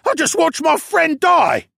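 very high, loud, drawn-out wail; anguished high-pitched protest; distraught shout. A narrator's reading. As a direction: cry it out slowly at the top of the voice. Panicked captive protests his ordeal.

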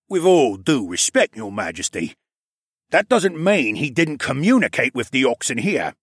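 politely but firmly press the point; insistent. A narrator's reading. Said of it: "Nawel insisted".

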